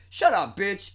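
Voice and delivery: high, loud snap back; mock angry retort. Crying brother answering the bride, mock angry retort. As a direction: fire back in mock outrage.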